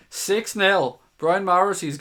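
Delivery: high, loud score call; excited read-out. Real, not acted. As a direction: shout out the bold prediction. Reading bold score prediction.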